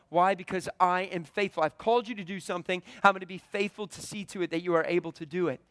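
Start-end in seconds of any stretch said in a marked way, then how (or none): none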